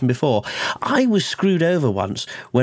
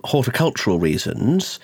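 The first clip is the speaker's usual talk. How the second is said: usual speaking voice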